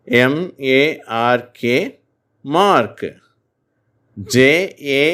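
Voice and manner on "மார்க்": "மார்க்" said clearly with a long aa; repeated model word. Shown as a demonstration. Teacher repeats the example for students, clear demo. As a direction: give "மார்க்" again, crisp and firm. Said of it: "நான் மறுபடியும் சொல்றேன்".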